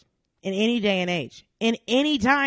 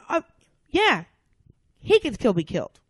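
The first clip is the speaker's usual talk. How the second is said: ordinary delivery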